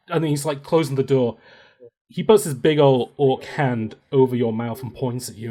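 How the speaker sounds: normal talking voice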